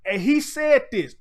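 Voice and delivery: ordinary talk, nothing marked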